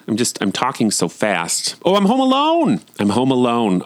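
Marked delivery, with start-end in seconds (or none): 1.8-2.8 much higher, thrilled squeal of freedom; giddy delight. Real, not acted. Mom alone at home for once, giddy high delight. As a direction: sing out the joy of an empty house. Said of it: "Just gonna interrupt for a moment".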